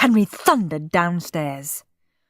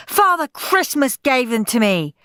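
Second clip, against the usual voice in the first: screaming; 7 semitones higher, 4 dB louder than usual. Acted. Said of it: "screamed Henry".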